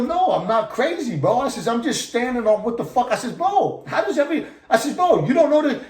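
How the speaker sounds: high-pitched, incredulous retelling; worked-up protest. Retold or imitated. Inmate insisting to another he isn't crazy, worked-up high protest.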